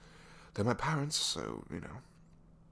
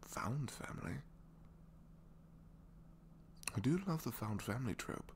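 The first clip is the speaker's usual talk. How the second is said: soft breathy whisper; 9 semitones higher, 5 dB quieter than usual. Acted. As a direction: whisper it like a secret. Shy aside to listener.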